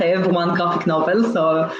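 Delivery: ordinary talk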